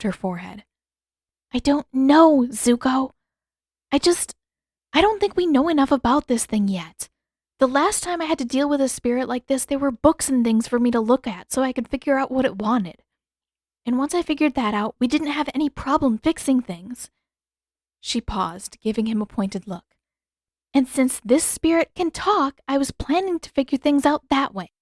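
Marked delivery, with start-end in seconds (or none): 1.5-17.1 put-on higher, louder young woman pleading her case; young heroine voice, heated; Katara, insistent. A narrator's reading. 20.7-24.7 put-on higher, louder young woman pleading her case; young heroine voice, heated; Katara, insistent. A narrator's reading.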